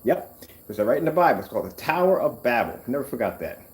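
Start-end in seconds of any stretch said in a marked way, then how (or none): none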